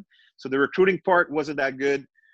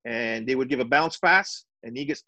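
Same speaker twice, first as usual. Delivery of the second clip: ordinary talk, nothing marked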